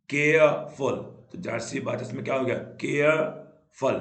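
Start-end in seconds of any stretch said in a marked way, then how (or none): 0.1-0.9 केयरफुल louder, parted as केयर, फुल; teacher beating out केयरफुल in two parts; syllable split. Shown as a demonstration. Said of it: "दो syllable हो गए". 2.8-3.9 केयरफुल very slowly, केयर then फुल; loud, stretched केयर, a pause, then फुल; syllable split. Shown as a demonstration. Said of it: "दो syllable हो गए".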